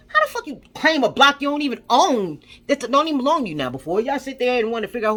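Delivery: fed-up yelling at the gangs; angry outburst. Real, not acted. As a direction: let the anger boil over. Ranting angrily at gang members.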